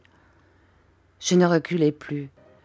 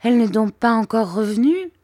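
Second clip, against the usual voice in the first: higher-pitched plaintive question; child's dismayed plea; 6 semitones higher, 4 dB louder than usual. A narrator's reading. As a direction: lament it as a question. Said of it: "dis-je d'un ton lamentable".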